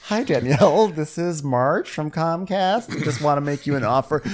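high-pitched mock sales pitch; put-on phone sales voice; telemarketer. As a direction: chirp it like a cold caller. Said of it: "They're like the telemarketer who's like".

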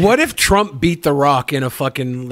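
ordinary talk, nothing marked

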